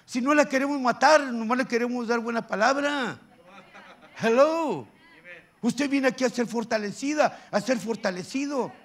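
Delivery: emphatic pulpit challenge; forceful preaching. Acted. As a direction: press the congregation for a response. Exhorts the congregation.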